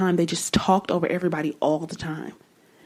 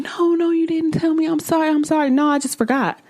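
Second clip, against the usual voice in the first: much higher, hammed-up flustered apology; mock apologetic interrupter; 11 semitones higher than usual. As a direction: mimic the sorry, sorry of someone caught interrupting. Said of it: "And they came".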